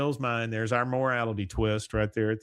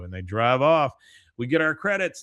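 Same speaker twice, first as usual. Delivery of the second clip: normal talking voice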